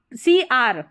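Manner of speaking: usual speaking voice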